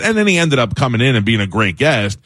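normal talking voice

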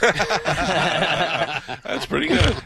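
laughing praise. Real, not acted.

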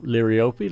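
liriope said as leer-ee-OH-pee; liriope, last e sounded as ee; variant pronunciation. Shown as a demonstration. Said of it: "so many ways to pronounce".

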